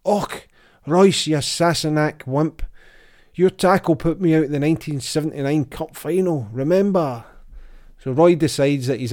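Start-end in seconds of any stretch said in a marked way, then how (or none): 0.0-7.2 higher, louder mock Scottish brogue; a hint of a furious Scottish ex-player, in a Scottish accent. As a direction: ham up an angry Scotsman with a Scottish accent. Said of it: "talking over the speakers in a Scottish accent".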